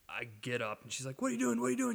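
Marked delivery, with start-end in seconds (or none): 1.2-1.9 freaking out. Retold or imitated. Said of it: "She's freaking out".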